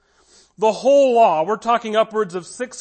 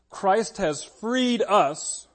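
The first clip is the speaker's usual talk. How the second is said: ordinary talk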